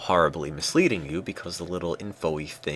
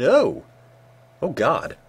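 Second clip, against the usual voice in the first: surprised; 8 semitones higher, 8 dB louder, slower than usual. Real, not acted. Election count suddenly shifts.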